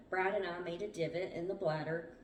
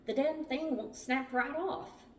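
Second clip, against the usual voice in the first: higher, slow exasperated complaint; frustrated; 8 semitones higher, 3 dB louder than usual. A narrator's reading. Brad after falling back from the plug, frustrated.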